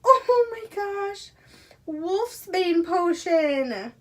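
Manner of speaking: high, loud and slow, thrilled; delighted unboxing shriek; excited squeal. Real, not acted. Unboxing a favourite item.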